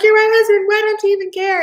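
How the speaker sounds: high, loud, tearful plea cracking upward; crying plea. Retold or imitated. Wife pleading with her husband in the car, high, loud and tearful. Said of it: "that made me cry more".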